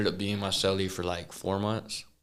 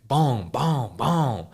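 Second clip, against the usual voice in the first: pitched-up, louder boom sounds for kicks; imitated door kicks; 5 semitones higher, 4 dB louder, much slower than usual. Telling his cellie's emergency, mimicking kicks on door. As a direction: bang out each boom like a fist on a cell door. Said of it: "I start kicking it".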